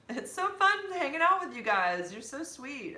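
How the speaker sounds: high, loud gush of delight. Real, not acted. Telling new friends they're sweet, gushing. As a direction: gush it high, easing down in volume.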